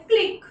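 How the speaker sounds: "क्लिक" with a quick short ई, pitched high. Shown as a demonstration. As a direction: snap through the ई in "क्लिक". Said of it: "फटाक से ई का pronunciation होता है".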